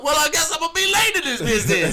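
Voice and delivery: high, loud hammed-up realization; comic surrender. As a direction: squeal it as a delighted punchline. Retold comic change of mind.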